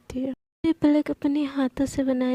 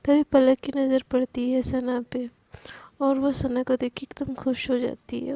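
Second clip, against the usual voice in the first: usual speaking voice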